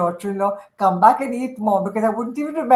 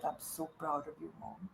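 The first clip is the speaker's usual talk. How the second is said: soft proud words; 5 semitones lower, 20 dB quieter than usual. Retold or imitated. Son finally praises his mother.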